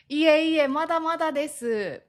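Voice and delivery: high, self-effacing polite denial; modest demurral. Shown as a demonstration. Praised for good Japanese, humble and reserved. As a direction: lift the voice and humbly deflect praise. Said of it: "こう遠慮がちに言うこと".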